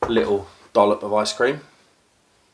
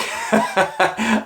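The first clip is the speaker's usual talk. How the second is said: laugh; 8 semitones higher than usual. Real, not acted. Laughs at calling himself a pig.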